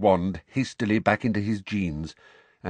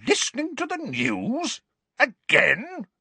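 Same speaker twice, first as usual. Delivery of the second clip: outraged disbelief. A narrator's reading. Uncle outraged by the boy's excuse. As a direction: throw his words back in disbelief.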